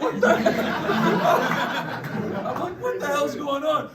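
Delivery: high, slow, laughing "what the hell"; retelling the neighbor's threat, laughing in disbelief. Retold or imitated. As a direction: stretch it out through laughter. Retold shock at the neighbor.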